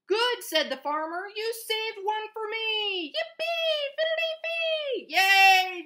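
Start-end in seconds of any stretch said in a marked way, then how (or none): 0.1-0.4 high, loud happy exclamation; delighted farmer. A narrator's reading. Farmer finding one apple saved, delighted cry. 1.3-5.1 high, stretched singsong cheer; delighted farmer. A narrator's reading. Farmer cheering that they saved one apple, overjoyed. 5.1-5.8 loud, high yay; excited cheer. Acted. Teacher cheering for the farmer's saved apple, excited.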